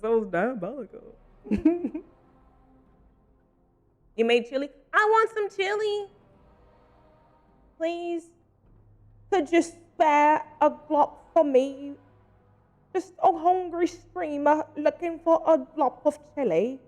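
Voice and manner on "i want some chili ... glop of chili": high, slow, put-on pleading beggar. Hungry streamer begging chat for chili, in a mock pitiful high voice. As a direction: beg for food like a pitiful waif, drawn out.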